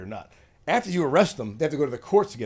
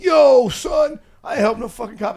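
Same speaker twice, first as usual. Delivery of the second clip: higher, louder street-tough retort; mock tough guy; 10 semitones higher, 9 dB louder, slower than usual. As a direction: bark it back like an offended tough guy. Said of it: "And the guy's like".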